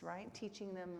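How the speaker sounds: usual speaking voice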